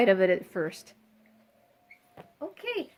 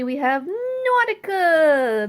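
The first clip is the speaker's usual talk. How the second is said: loud, higher, drawn-out name reveal; mock grand introduction; 5 semitones higher, 8 dB louder, slower than usual. Presenting the last parrot, mock grand announcement. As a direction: stretch the name out like a show announcer.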